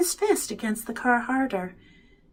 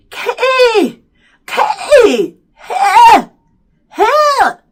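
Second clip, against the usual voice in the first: high, loud garbled cries; mouth-full mumbled yell; 13 semitones higher, 11 dB louder, much slower than usual. A narrator's reading. Byron calls for his brother with lips stuck, garbled yelling. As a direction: call out as if the lips can't close. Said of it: "sounding like he had something in his mouth".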